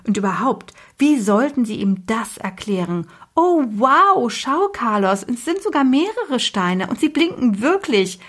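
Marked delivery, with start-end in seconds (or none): 3.4-8.2 mild staged amazement; mock excitement. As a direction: play up the fake discovery to distract. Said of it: "rief Alva".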